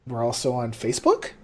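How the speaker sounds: ordinary talk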